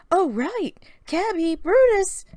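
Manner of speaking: Mary calling her Pokemon over. A narrator's reading. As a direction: call them in from the other room. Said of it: "Mary called".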